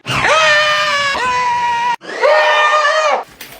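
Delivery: long, very high, loud screech; kid scream. Acted. Billy crashing into Mom's tree, loud high scream. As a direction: scream as the toy car hits the tree.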